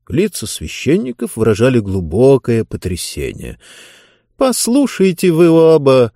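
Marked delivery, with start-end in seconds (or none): 4.4-6.1 high, louder, scornful call; mock the two of them. A narrator's reading. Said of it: "насмешливый голос книги".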